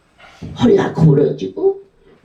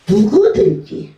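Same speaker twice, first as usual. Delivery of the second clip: ordinary talk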